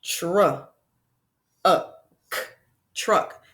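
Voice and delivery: segmented slowly into sounds; sound-by-sound segmenting; sounds tapped out. Shown as a demonstration. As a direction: tap out each sound, tr, uh, k, then blend. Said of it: "we hear".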